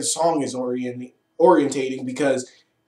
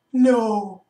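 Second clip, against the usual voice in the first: higher, hint of a dismayed lover; lovestruck protest; 6 semitones higher than usual. Ramses protests the Pink Ranger's fight, mild dismay. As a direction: whine it like he can't believe it. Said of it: "Ramses is like".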